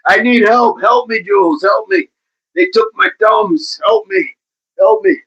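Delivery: high, squeaky fake cry for help; hammed-up helpless squeal; mock plea for help. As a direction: beg for help in a tiny joke voice. Joking plea after laughing at Jules.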